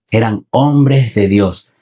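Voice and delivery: slow, weighty "Eran hombres de Dios", stress on "hombres"; deliberate preacher's repeat; slow emphasis. Real, not acted. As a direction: drag it out to drive it home.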